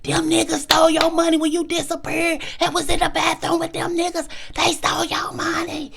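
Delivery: high-pitched heated protest; indignant retelling. Retold or imitated. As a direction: insist hotly, voice up high. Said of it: "And I said in front of".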